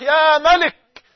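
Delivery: slow loud cry fading with each repeat; retold shouted plea, dying down. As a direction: call out to the King, then let it ebb. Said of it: "فظللت أصرخ".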